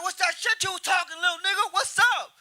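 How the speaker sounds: high-pitched acted challenge; aggressive. Angry man confronts comic outside his car, mock aggressive. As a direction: imitate a man squaring up for a fight. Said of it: "He got out of his car all aggressive".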